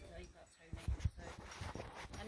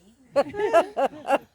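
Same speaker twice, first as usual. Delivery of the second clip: loud laugh, pitched up; real laugh; 6 semitones higher, 16 dB louder than usual. Real, not acted. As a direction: laugh at the pretend family. After the Daddy Tony line.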